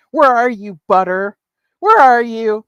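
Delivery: loud mock summons; calling out. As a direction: call out loudly as if searching the room. Calls out for Butter in chat.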